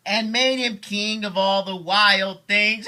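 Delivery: dad hails Max as king, thrilled; excited proclamation. Acted. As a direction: announce the new king with glee.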